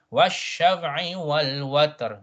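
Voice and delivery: slow, deliberate verse reading; drawn-out Quranic model; tajweed recitation. Shown as a demonstration. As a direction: recite with both last consonants sounded. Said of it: "last two letters must be pronounced".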